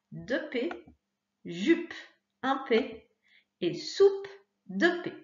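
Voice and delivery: slow, spaced list, each word pronounced clearly; teacher correcting aloud, word after word; reading the correction. Shown as a demonstration. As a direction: go through the answers word by word for a child. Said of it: "pour la correction".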